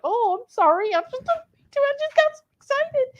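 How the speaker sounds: high, eager little-dog voice, voiced apologizing; apologetic puppy voice. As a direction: play the overexcited pup saying sorry to the old dog. Voicing the excited young dog.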